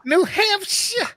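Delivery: high-pitched, put-on New Hampshire accent. Shown as a demonstration. Man joking that he can't do accents, mock New Hampshire accent. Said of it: "I can't do the Mississippi accent".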